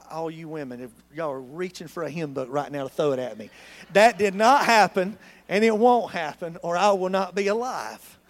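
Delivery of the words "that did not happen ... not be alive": emphatic joke. Real, not acted. Joking about wife's reaction.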